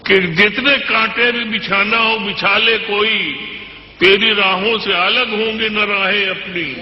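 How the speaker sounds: measured, declamatory poetry recital; stately nazm recitation. Acted. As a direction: recite each couplet with weight.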